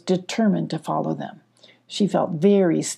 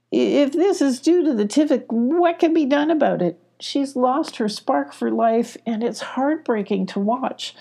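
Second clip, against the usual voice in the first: much higher, pained pleading; distressed Moon; 7 semitones higher than usual. Acted. Moon begging Mama Owl for help, higher and pained. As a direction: ask with a heavy heart.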